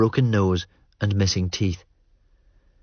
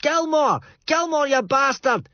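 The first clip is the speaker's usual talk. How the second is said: much higher, raging shout across the beach; furious yell. Acted. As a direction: bellow his name like a drunk madman. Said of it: "Fraser yells".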